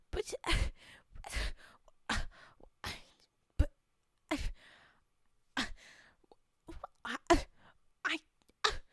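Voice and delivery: huffing mock fury with loud bursts; mock rage puffing. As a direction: puff and sputter in pretend rage. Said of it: "that was my rage puffing".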